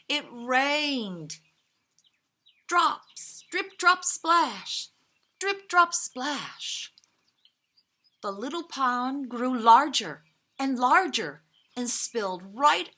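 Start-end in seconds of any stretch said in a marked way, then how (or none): none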